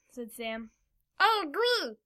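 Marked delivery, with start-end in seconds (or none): 1.2-2.0 high-pitched character voice; Kenzo. A narrator's reading. Kenzo agreeing before falling asleep, high character voice. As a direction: pitch it well up for a small character. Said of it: "said Kenzo as they all".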